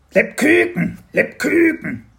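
high, loud squeaky-creature cry; excited Spekulatius creature. Acted. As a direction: cry it out like an eager little creature.

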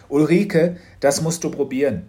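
father calling his wife over, loud and pleased. A narrator's reading. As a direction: call across to Ulrike, delighted. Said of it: "rief er".

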